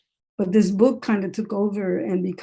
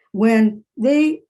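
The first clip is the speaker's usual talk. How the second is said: ordinary talk, nothing marked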